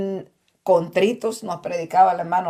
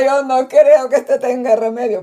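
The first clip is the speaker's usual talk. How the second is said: louder and higher, giving up on him; hopeless wife; 4 semitones higher, 7 dB louder than usual. Retold or imitated. Wife giving up on her husband, louder and higher. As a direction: declare it a lost cause, loudly.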